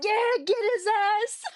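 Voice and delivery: high mock cheer. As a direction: squeak it out like a cheering fan. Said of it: "I'm like".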